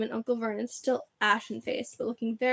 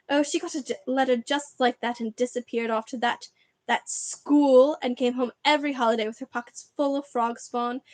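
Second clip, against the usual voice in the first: bitter ranting aunt; 4 dB louder than usual. Acted. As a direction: spit it all out, years of jealousy. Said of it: "went ranting on".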